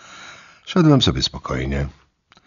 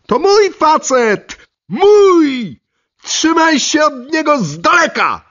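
very high, loud furious shriek of a jealous woman; jealous screaming; 8 dB louder, slower than usual. Acted. Lidia attacking the rival on the stairs, furious high shriek. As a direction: scream it, claiming your man.

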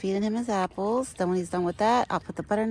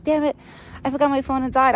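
frustrated husband; 6 semitones higher, 6 dB louder than usual. Retold or imitated. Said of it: "My husband's like".

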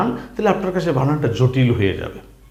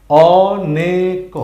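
অনেক stretched very slowly and a bit louder; drawn-out reading; 3 dB louder, much slower than usual. Shown as a demonstration. Teacher to learners, stretching অনেক very slowly. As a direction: sound out অনেক slowly, holding each syllable. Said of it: "তাহলে আমরা প্রথম লাইনে কি পেয়েছি?".

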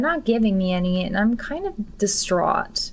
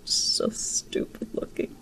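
whisper; 9 dB quieter, slower than usual. Real, not acted. Mocking a character's looks.

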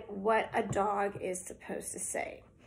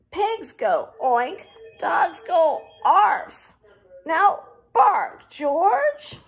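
high, loud, slow exasperated mum; building up to a cross command; exasperated mother; 7 semitones higher, 9 dB louder, slower than usual. Acted. Mother losing patience with George, loud and high. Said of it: "said George's mother".

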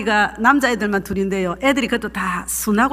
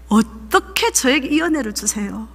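ordinary talk, nothing marked

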